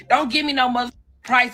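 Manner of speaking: ordinary talk, nothing marked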